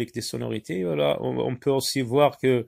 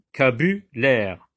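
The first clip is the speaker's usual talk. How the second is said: vocabulaire spelled out slowly, syllable by syllable; slower than usual. Shown as a demonstration. Teacher unpacks the pun for pupils, slow and deliberate. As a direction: break vocabulaire apart slowly so le veau qu'a bu l'air shows. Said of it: "vous allez voir que il s'agit du".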